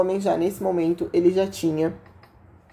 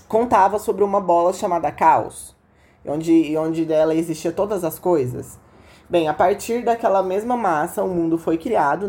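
ordinary talk, nothing marked

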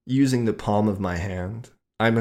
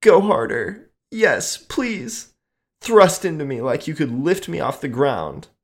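loud, high pleading moan; 8 semitones higher, 6 dB louder than usual. Acted. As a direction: beg for more, loud and high. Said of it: "she moaned".

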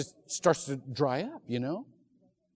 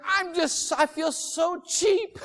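high mock lament; 12 semitones higher, 5 dB louder, slower than usual. Confessing cheapness, high.

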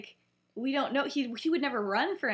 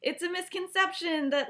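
high, louder defensive protest; flustered; 8 semitones higher, 4 dB louder than usual. Retold or imitated. Lily defending what Snape told her, flustered. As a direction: voice jumps up, imitating someone caught not knowing.

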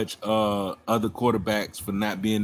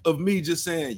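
usual speaking voice

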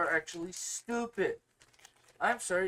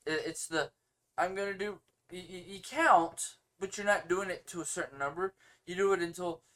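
usual speaking voice

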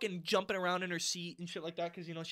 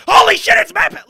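high, very loud excited yell; screamed outburst; 23 dB louder than usual. Retold or imitated. High schooler when Matt Pat showed up, screaming. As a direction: scream it out like a starstruck kid. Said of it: "Like, he screamed".

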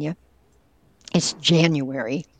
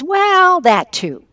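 "Well" jumps much higher and louder, then "that too" tossed off; dismissive afterthought; 13 semitones higher, 8 dB louder, much slower than usual. Retold or imitated. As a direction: brush it aside as if it hardly counts. Dad shrugging off World War Two.

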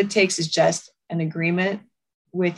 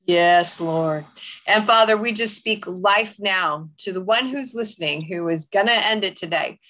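fervent declaring prayer; 3 semitones higher than usual. Acted. Host praying over a suicidal listener, fervent declaration. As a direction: speak life over the listener.

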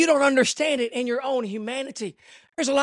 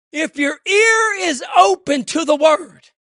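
loud, high, ringing sermon cry; fiery pulpit preaching; full-force preaching; 6 semitones higher, 9 dB louder, slower than usual. Acted. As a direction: preach it out to the whole church. Preaching burst.

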